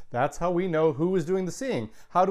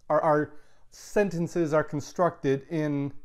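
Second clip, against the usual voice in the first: ordinary talk, nothing marked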